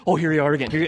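excited dog voice. Retold or imitated.

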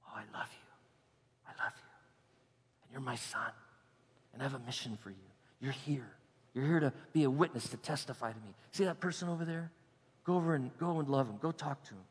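soft, low intimate whisper in the ear; whispering God. Acted. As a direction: lean in and whisper like God to his son. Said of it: "the picture of God just coming alongside of us and saying".

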